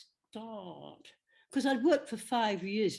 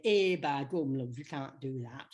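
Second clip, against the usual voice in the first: a hint of northern English accent; grandmother's homely Lancashire phrase; Lancashire saying; 4 semitones lower than usual. Imitating grandmother in Lancashire accent. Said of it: "she used to enjoy, uh, say".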